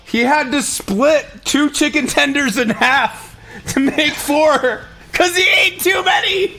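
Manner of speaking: excited. Real, not acted. Teasing Ryan, laughing.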